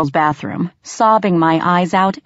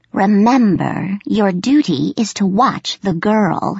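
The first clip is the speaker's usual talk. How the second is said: gravelly warning; 3 semitones higher than usual. A narrator's reading. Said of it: "warned a low, gravelly voice".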